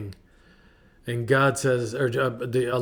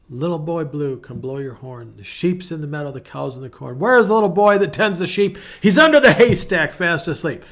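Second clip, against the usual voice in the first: sing-song rhyme, swelling louder and higher near the end; reciting a rhyme with rising gusto; nursery rhyme recital; 3 semitones higher, 6 dB louder than usual. Acted. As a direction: recite it like to a child. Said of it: "reminds me of a nursery rhyme".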